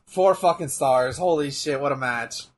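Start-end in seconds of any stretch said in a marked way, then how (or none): none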